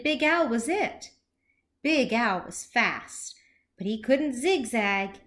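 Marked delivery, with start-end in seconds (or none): none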